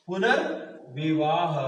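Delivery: पुनर्विवाह drawn out slowly, पुनर् set apart; slow teaching pronunciation of पुनर्विवाह; syllable-by-syllable pronunciation. Shown as a demonstration. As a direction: say पुनर्विवाह slowly in two parts, पुनर् then विवाह. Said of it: "इसका उच्चारण तो यही है".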